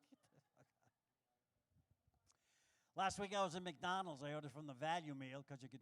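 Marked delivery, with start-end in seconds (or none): 0.1-0.8 breathless whispered curse underwater. Retold or imitated. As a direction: mouth the words in a whisper. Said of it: "I'm like".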